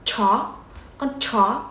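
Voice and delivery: "chó" bật mạnh hơi như ch tiếng Trung; "Con chó" với "ch" đẩy hơi ra. Shown as a demonstration. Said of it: "kiểu bật hơi". ("chó" phát âm bật hơi)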